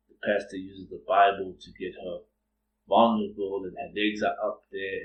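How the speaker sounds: normal talking voice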